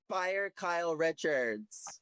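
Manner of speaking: slow, pointed demand to Andy; reality tv confessional. Pretend. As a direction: deliver it like a dramatic confessional. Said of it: "Pretend you're in a confessional".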